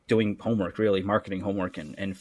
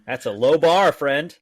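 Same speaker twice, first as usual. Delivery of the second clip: louder, higher teasing jab; laughing tease; 5 semitones higher, 8 dB louder than usual. Real, not acted. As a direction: rib the guest loudly between laughs. Teasing after joke.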